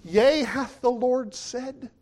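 slow, high, lightly voiced serpent; devil misquoting. Serpent speaking to Eve, slow and sly. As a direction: draw it out like the tempter. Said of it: "what he said with Eve".

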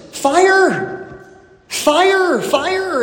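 high urgent cries of alarm; shouting fire. Acted. Crowd outside a burning house, high urgent cries. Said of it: "voices outside get louder".

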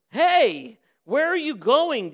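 high, loud, alarmed shout after him; alarmed demand. Acted. Holy man shouts in alarm at departing farmer, loud and high. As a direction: panic as the farmer walks off. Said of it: "demanded the suddenly alarmed holy man".

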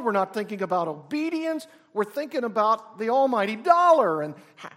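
loud, driving preacher voice; full-force preaching. Acted. Preacher hammers the congregation about chasing riches.

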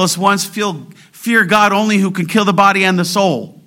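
high-pitched, forceful exhortation; impassioned preaching. Real, not acted. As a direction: drive each phrase home fervently.

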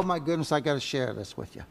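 usual speaking voice

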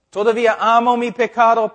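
confesión imitada. Retold or imitated. Pecador confiesa amar su pecado.

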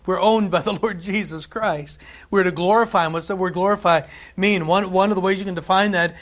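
preaching. Acted. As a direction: preach it with growing force. Preaching builds to full force.

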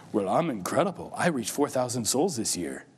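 puffed-up boast. Retold or imitated. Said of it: "we puff ourselves up and go".

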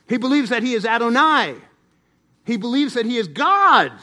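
loud, rising pulpit proclamation; full-force preaching. Real, not acted. Preacher declaring that he is God, loud emphatic proclamation. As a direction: thunder each divine name with conviction.

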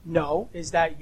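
ordinary delivery

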